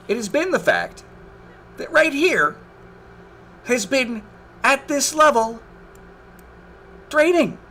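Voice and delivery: building exasperation, pitch rising; real frustrated complaint; exasperated rant. Real, not acted. Exasperated rant at slanting water.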